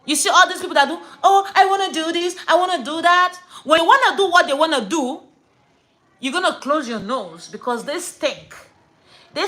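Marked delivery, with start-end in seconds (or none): 1.2-3.4 high, loud mock eager voice; mimicking show-offs. Mocking people who travel abroad, high and affected. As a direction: put on a pretentious whine of wants.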